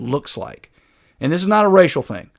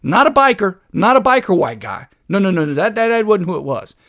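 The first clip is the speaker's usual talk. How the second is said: higher-pitched, emphatic mock protest; sarcastic denial; 5 semitones higher than usual. Host mocking her account of the attacker, sarcastically insistent. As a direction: deny it with exaggerated, sarcastic insistence. Sarcastically denying it was others.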